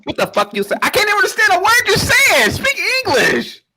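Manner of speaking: high, loud angry outburst; angry yell. Real, not acted. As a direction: yell it back at him, rising in anger. Heated argument, yelling back.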